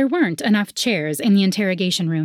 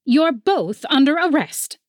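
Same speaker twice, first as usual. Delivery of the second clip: stern officer voice; 8 semitones higher, 3 dB louder than usual. A narrator's reading. Officer arrests them.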